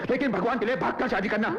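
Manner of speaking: high, exasperated outburst; worked-up family scolding. Acted. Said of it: "आहिस्ता बोलो".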